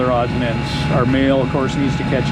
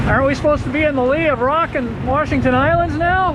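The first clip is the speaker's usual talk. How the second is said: raised voice calling across the deck; calling out over noise; 13 semitones higher than usual. Real, not acted. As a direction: call out over the wind. Calling across the boat, can't hear.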